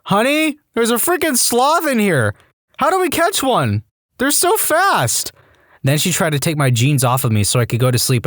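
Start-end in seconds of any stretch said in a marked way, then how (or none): 0.1-5.3 very high-pitched, drawn-out goofy wonder; amazed calling out. Acted. Groggy patient calls to wife about a sloth, amazed. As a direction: stretch it out high like a dazed, astonished patient. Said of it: "I asked out loud".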